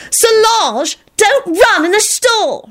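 very high, drawn-out scolding call; angry yell. A narrator's reading. Elizabeth calling after Solange, angry yell. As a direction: yell crossly across a shop. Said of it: "she yelled angrily".

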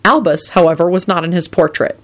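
ordinary delivery, nothing marked

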